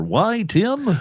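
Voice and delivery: bright high ad-read question; excited ad question. Acted. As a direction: pitch up like an ad. Scripted ad banter question.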